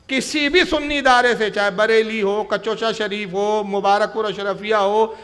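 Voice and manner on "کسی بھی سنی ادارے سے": louder, higher restatement of the challenge; emphatic louder repeat. Real, not acted. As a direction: press the challenge harder the second time. (دوبارہ زور دے کر، اونچی آواز میں)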